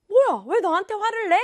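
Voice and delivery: high, loud, acted upset; hurt and tearful protest. Teacher voices the sister being yelled at, hurt protest. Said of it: "눈에 눈물이 그렁그렁 하면서".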